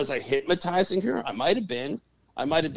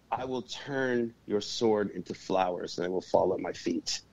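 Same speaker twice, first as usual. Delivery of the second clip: very calm; 4 semitones lower, 4 dB quieter than usual. Retold or imitated. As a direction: answer a threat with quiet composure, dropping lower. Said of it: "I very calmly says".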